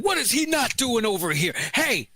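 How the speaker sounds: mock yell at the kneeling player; mock outrage. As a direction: play the offended viewer calling out. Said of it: "They were like".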